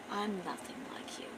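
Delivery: hushed, whispered final word; cold whispered retort. Acted. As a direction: whisper it as a parting verdict. Heroine coldly finishes off the villain.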